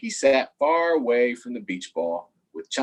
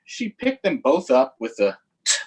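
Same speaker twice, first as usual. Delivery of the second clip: usual speaking voice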